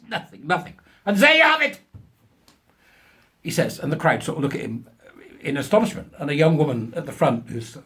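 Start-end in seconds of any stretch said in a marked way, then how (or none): 1.0-1.8 mock German professor. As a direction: ham up a self-important German scientist. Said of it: "of German extraction".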